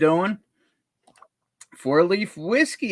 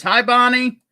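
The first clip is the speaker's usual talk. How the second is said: mock greeting; 9 semitones higher, 8 dB louder than usual. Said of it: "Stacy says".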